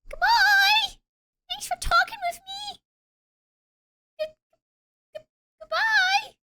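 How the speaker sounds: high squeaky cartoon character goodbye. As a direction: chirp the farewells like a little cartoon critter. Cutesy high-voiced sign-off.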